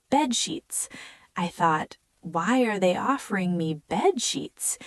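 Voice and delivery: usual speaking voice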